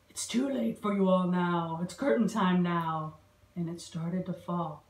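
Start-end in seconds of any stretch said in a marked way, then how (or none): none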